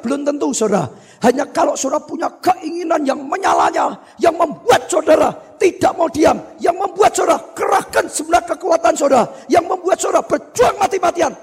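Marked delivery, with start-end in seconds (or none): 1.2-11.3 rising, insistent exhortation; fiery preaching. Real, not acted. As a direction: build phrase by phrase to a high climax. Preaching at full force.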